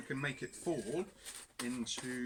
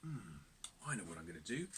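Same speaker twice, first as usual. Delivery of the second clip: lower, softer inner thought; a crafty idea, said under the breath; thinking aloud; 8 semitones lower, 9 dB quieter, faster than usual. Acted. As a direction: muse quietly to yourself. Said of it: "And he thought".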